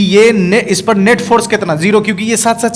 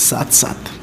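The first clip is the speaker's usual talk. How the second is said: whispered; 6 semitones lower, 8 dB quieter, slower than usual. Acted. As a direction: whisper "साथ साथ" breathily, no voice. Whispers the key phrase. (फुसफुसाकर "साथ साथ")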